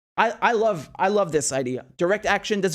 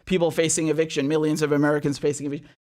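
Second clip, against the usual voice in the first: sarcastic mock contrition; mock apology. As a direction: ham up a fake sorry, then let it sag.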